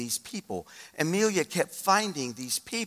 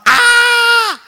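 very high, loud held scream; piercing overjoyed scream; 14 dB louder than usual. Retold or imitated. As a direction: imitate a woman shrieking down the phone. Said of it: "I hear Judy screaming through the phone".

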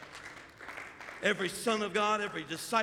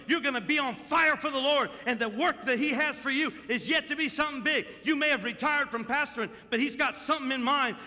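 full-force preaching; 3 semitones higher than usual. Acted. Pastor preaching over John, building to full force. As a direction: lift the room, preach it hard.

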